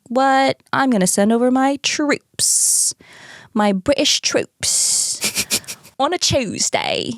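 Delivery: King George in a hammed-up Cockney accent; mock Cockney king. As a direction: play a silly Cockney king, half-joking. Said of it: "A Cockney accent for the King".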